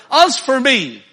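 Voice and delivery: loud, high, emphatic "As for me"; emphatic self-reference. Shown as a demonstration. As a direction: punch "As" and "me" with full force. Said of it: "That's the way we read. Or".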